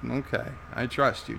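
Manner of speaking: normal talking voice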